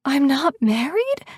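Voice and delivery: high, slow disbelieving rasp; stunned question, drawn out and rising high. A narrator's reading. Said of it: "The question is a rasp".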